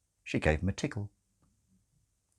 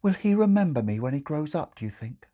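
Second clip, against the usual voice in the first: wistful; 7 semitones higher, faster than usual. Acted. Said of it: "said Sister Mary wistfully".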